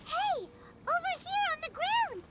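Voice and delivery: calling out. Acted. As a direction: call your companions over. Calling companions to a body found.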